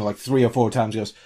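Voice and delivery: normal talking voice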